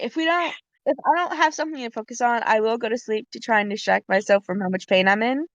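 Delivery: ordinary talk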